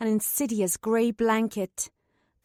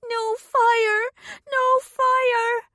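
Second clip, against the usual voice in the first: put-on very high, louder child's wail; piercing wail; 13 semitones higher, 5 dB louder, much slower than usual. A narrator's reading. Ghost child wails at Katrin about the fire, very high. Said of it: "began a piercing wail".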